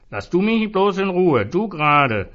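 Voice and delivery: high, loud snarl; furious drunken lash-out; angry outburst. A narrator's reading. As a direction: shove him off with words. Zoran lashing out at Jesus.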